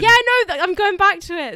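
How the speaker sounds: high, loud retort to an interruption; loud defensive protest. Real, not acted. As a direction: cut in loudly to defend yourself. Defends herself when interrupted.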